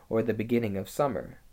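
ordinary delivery, nothing marked

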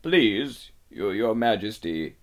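faltering, timid fox; nervous pleading. A narrator's reading. Old fox answers the angry witch, nervous pleading. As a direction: stumble over the words before a feared queen.

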